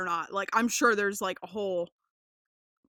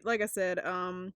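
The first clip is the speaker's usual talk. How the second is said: ordinary talk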